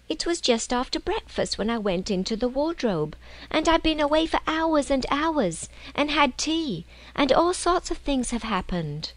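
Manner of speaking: put-on a small girl bursting to tell her adventure; earnest young girl. Lucy insisting to her siblings she was away for hours, earnest.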